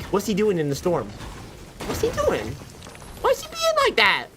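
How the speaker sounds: imagined viewers asking. Retold or imitated. Imagined viewers puzzling over him, squeaky mock voice. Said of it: "You guys are probably like".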